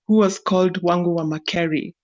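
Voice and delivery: usual speaking voice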